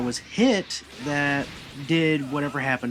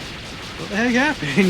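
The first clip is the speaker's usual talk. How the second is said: slow, higher-pitched mock bewilderment; confused; 5 semitones higher, slower than usual. Goku waking up after the knockout, confused. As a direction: imitate someone waking up puzzled. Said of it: "he's kinda confused".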